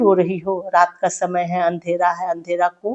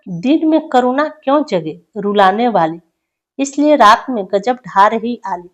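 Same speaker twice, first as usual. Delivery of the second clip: raised, measured poetic recital; poem recitation; 2 semitones higher, 4 dB louder than usual. Acted. Teacher reading a prisoner's poem aloud to students, declaiming. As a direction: recite each line with weight to the class.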